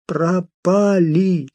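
slow, sepulchral creepy butler. Acted. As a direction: drag it out like a gravedigger. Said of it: "за могильным голосом".

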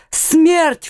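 high, shocked cry. A narrator's reading. Old father hears his son's sentence, crying out high. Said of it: "вскрикнул старик".

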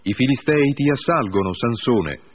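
high, loud cry of alarm; shouted warning. A narrator's reading. Woman shouting to rouse her sleeping lover, loud alarm. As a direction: call out sharply as if danger is at the door. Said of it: "Poi essa gli gridò".

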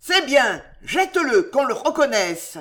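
high, loud command from below; commanding, carrying shout; shouted order. A narrator's reading. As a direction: call the order up to the window. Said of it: "qui criait à Bem".

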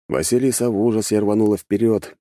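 ordinary delivery, nothing marked

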